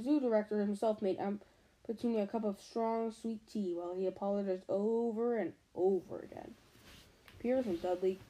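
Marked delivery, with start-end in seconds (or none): none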